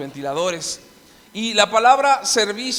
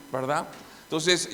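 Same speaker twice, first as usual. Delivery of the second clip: ordinary talk, nothing marked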